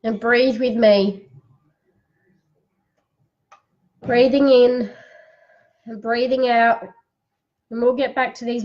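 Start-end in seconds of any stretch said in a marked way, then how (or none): 0.0-6.8 guided breathing. Acted. Leads a breathing exercise.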